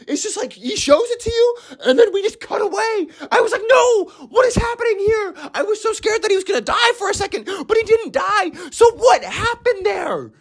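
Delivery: very high, worked-up squeal; agitated, pitch held way up; distressed disbelief. Real, not acted. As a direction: whine it in a high voice, baffled and upset. Distressed recap of cliffhanger scene.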